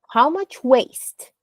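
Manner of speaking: "how much waste" lifted high and drawn out; slow, high question on "waste". Shown as a demonstration. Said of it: "go up".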